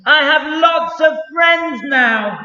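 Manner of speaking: shouted out high and loud with joy. Acted. Little Beaver shouts his news across the pond, joyful shout. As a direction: belt it out across the pond, happy. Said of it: "said it again very loudly".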